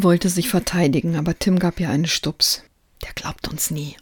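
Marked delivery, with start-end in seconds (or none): 3.0-4.0 hushed, secretive aside; whisper. A narrator's reading. Tim warns Marie in secret, whispering. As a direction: whisper it so only your friend hears. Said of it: "flüsterte er".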